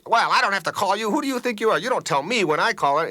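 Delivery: high, loud indignant comeback; defensive retort. Acted. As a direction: fire back as if in a quarrel. Acted defensive retort in quarrel.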